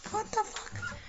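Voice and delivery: squeaky high incredulous cry; shocked disbelief. Retold or imitated. Cashier reacting to the insult, shocked squeak. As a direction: pitch way up in stunned disbelief.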